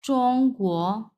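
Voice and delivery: চংগুও very slow with rising end; slowly rejoin চং and গুও; slow model. Shown as a demonstration. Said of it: "পরেরটা গুও".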